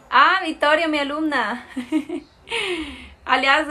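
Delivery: normal talking voice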